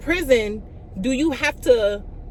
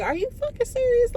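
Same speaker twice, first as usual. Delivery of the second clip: incredulous; 14 semitones higher than usual. Retold or imitated. Retelling her disbelief at the officer, pitched way up. Jailer says she must stay.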